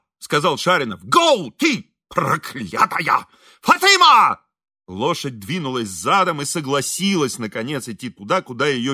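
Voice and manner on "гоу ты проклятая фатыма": drawn-out curse rising to a high yell; exasperated calling out. A narrator's reading. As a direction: drive the horse on, then bellow for help. Шаринов гонит лошадь, зовёт Фатыму. (раздражённый окрик, переходящий в крик)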